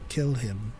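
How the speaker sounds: low, soft voice of a lurking villain; lightly voiced sinister command, dropped low; Palpatine. Host quotes a bodiless voice from the novel, softly sinister. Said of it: "I read that in Palpatine's voice".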